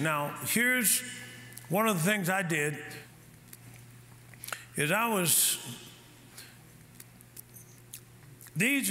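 slow, low teaching. Acted. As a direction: let each phrase settle.

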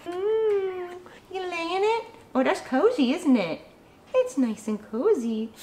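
soft, doting pet talk; cooing to pet. Real, not acted. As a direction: coo gently to a cozy dog. Settling the pet in cozy spot.

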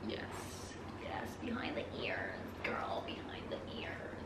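very soft, low, breath-only murmur; low whisper. Real, not acted. Vlogger dabbing perfume behind the ears, low whisper. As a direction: whisper it low, drawing out the end.

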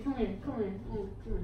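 ordinary delivery

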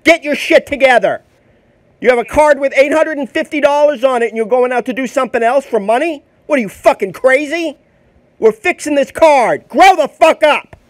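loud, high-pitched real tirade; angry scolding. Real, not acted. Scolding someone over fixing a card, furious shouting. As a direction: lay into them at full volume, furious.

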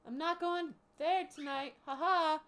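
high, loud taunting bird voice; mocking bird voice. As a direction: jeer like a cheeky bird escaping. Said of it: "she's yelling at her".

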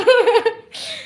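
bursts into a high giggle; laughing along with her friend. Real, not acted. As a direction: let a real giggle escape.

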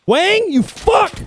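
slow, high, loud drawn-out curse; angry yell at Wang. Real, not acted. Player killed by teammate Wang, slow furious yell. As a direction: yell the name, drag out the curse.

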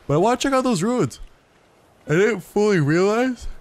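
talking through a yawn; words stretched by a yawn. Real, not acted. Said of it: "I'm so sorry for yawning".

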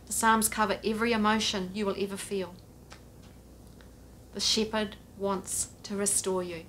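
ordinary talk, nothing marked

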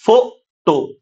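"फो, टो" split into two separate syllables. Shown as a demonstration. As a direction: pause between "फो" and "टो". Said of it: "ये एक syllable हो गया". ("फो, टो" को अक्षर तोड़कर बोलना)

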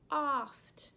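Oft said loudly with a long open "aw" vowel, like "awft"; sounding out oft. Shown as a demonstration. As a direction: boom out Oft to show the "aw" sound. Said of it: "The O makes the aw sound".

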